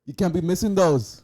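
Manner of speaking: mock British accent. Mocking a British player on the pitch, British accent. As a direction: yell at a teammate in a hammed-up British accent. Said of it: "British accent".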